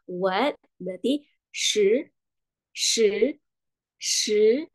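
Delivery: "shi" rising like "what"; second tone. Shown as a demonstration. Teacher models the word for ten, "shi" rising. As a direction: lift each "shi" into a question. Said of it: "dengan nada kedua".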